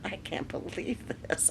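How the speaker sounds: "I can't believe this" in a retold whisper, no voice. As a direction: breathe it out in disbelief. Rathbone aghast at the tantrum.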